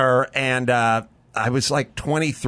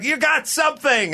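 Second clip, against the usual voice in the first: teacher insisting. Retold or imitated. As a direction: push the actor to commit. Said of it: "She's like".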